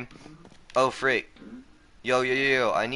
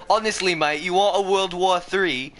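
higher, louder fake British accent, laughing; mock British; 7 semitones higher, 3 dB louder than usual. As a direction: taunt like a cheeky Brit. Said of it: "keep making fun of it".

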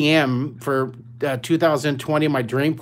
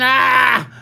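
mock frustrated cry; 8 semitones higher, 6 dB louder than usual. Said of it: "roll up your paper and say".

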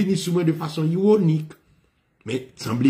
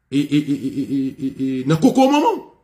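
flabbergasted. Retold or imitated. Student repeating the rude answer he just heard, flabbergasted. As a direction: imitate a stunned man sputtering back the words. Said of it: "monsieur estomac".

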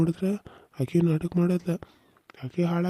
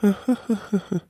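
gloating laugh; 6 semitones higher than usual. Acted. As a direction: laugh in cruel triumph. Villain son gloats in quarrel. (ಕ್ರೂರವಾದ ಗೆಲುವಿನ ನಗು)

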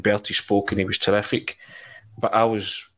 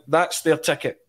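higher, grand dismissive star; 6 semitones higher than usual. Retold or imitated. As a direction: wave it off like a host.

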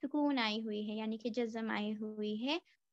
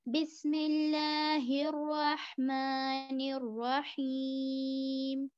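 slow melodic tajweed chant with long pauses; Quran recitation; 2 semitones higher, much slower than usual. Shown as a demonstration. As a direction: recite each phrase slowly, stretching كلا and سيعلمون. Full recitation after lesson. (धीमी सुरीली क़ुरआन तिलावत)

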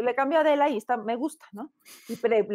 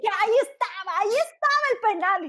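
high, far-off whiny fan voice; mocking a fan who is upset about a missed penalty; football fan complaining; 10 semitones higher than usual. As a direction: protest the penalty like a sore fan. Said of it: "la gente que ve el fútbol".

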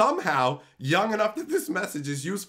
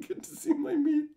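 laughing; 11 semitones higher than usual. Real, not acted. Laughing through punchline.

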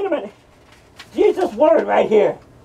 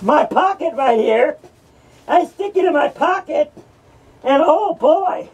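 ordinary talk

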